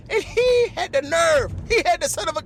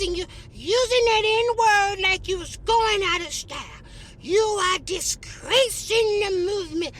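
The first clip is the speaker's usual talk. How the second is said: Rosa Parks voice, higher and slow, scolding; slow scolding old lady, higher pitched; 4 semitones higher, slower than usual. Retold or imitated. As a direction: play a stern old woman dressing him down. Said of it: "She said".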